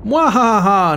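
hammed-up villain cackle; a loud mock evil laugh. Evil laugh after villains appear.

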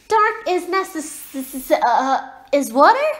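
high, slow stumble over "necess-"; baffled attempt. A narrator's reading. Owlet tries repeating the girl's long word, baffled. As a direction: break off "necess-", then ask loudly in confusion.

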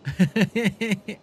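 high bright burst of laughter; delighted laugh. Real, not acted. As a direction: laugh out at how cute they are. Laughs at couple feeding.

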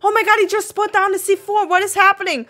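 high, fast, alarmed; mock panic. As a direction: blurt it out in playful panic. Watcher sees explosive put down.

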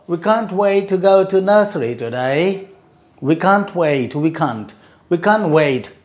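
usual speaking voice

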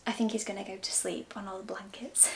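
hushed whisper by sleeping pet. Real, not acted. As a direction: whisper so he stays asleep. Pet asleep nearby.

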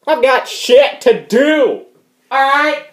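high, loud, slow hammed-up rant; over-the-top fury at a caller; outraged shouting. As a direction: stretch each shouted word in exasperation. Ranting about an unknown caller.

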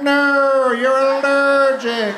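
loud, slow hammed-up warning; loud and drawn out, half laughing; mock scolding. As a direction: bark it out like a panicked hero. Acted reply, imagined chivalry.

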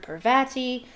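"Parvati" as par-VAT-ee; second-syllable emphasis. Shown as a demonstration. Reader lists versions heard, "Parvati" as par-VAT-ee. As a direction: stress the middle of "Parvati", A as in cat. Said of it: "which part to emphasize".

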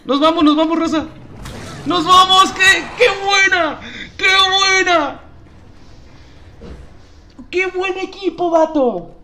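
victory hype. Real, not acted. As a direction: scream it like you just won the round. Celebrating a win in-game.

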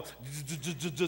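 mock childhood stutter on j; imitating stutter. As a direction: stammer the j like a boy stuck. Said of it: "'Cause I would go".

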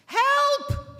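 high screamed cry for help. A narrator's reading. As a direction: shriek it out in panic. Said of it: "she screamed".

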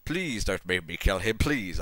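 ordinary delivery

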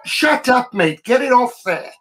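a half-joking mock yell at the screen; mock fan heckle. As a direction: heckle the director like a fed-up fan. Fans yelling at the TV director.

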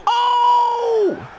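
Oh! shouted high and loud; excited shout. Real, not acted. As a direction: yell it out at a near miss. Big reaction to a play.

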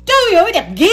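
high excited voice; excited praise. Real, not acted. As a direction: gush with delight.

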